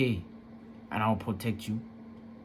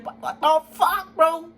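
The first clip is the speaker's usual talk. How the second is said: much higher, louder outraged yell; furious; 13 semitones higher, 5 dB louder, slower than usual. Real, not acted. Son outraged at mother's refusal to leave, much higher and louder. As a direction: erupt in disbelief. Angry outburst about mother.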